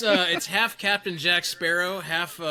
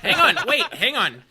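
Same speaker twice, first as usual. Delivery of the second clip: excited; 4 semitones higher, 6 dB louder than usual. Real, not acted. Laughing protest to interrupt host.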